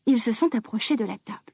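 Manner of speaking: usual speaking voice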